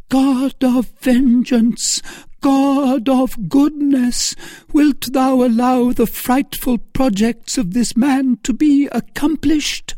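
high, drawn-out anguished plea; tearful prayer. A narrator's reading. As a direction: cry out to God through tears, loud and high. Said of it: "in a voice broken by tears".

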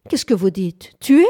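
éclater d'une voix stridente, incrédule. Acted. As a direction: question lancée plus fort, stridente. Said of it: "fit-elle d'une voix stridente". (voix stridente et incrédule)

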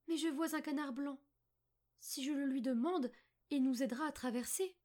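put-on high, light girl's voice; little girl Margot. Margot spots a duck to help them cross, high girl's voice.

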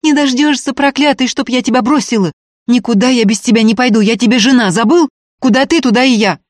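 high, loud, defiant tirade; stubborn woman screaming at her man; furious yelling. A narrator's reading. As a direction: shout him down with near hatred. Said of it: "кричала Устинья".

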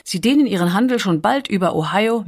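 plain reading voice

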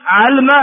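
علم as 'alma, no vowel on the lam; middle vowel dropped. Shown as a demonstration. Teacher listing three verb variants, علم said 'alma. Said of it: "فيه ثلاث لغات فقط".

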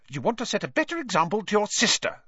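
quick, high-pitched scolding; sharp, hurried reproof; snapped. A narrator's reading. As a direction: snap at the boys as mother. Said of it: "snapped Missus Weasley".

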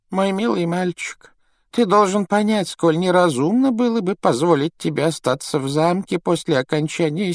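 put-on high-pitched, frail old man's voice; gently explain, with old man's hesitations; kindly old headmaster. A narrator's reading. Headmaster explains the danger at school, kindly but troubled.

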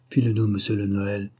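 plain reading voice

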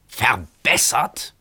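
drawn-out, slow, incredulous; malicious outrage. A narrator's reading. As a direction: spit the word back in disbelief. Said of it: "schrie er in höchster Bosheit".

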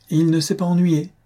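high, bright boast of a proud father; triumphant. A narrator's reading. Father boasting to the mother-in-law, triumphant and high. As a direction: crow it out high and pleased. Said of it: "d'un air triomphant".

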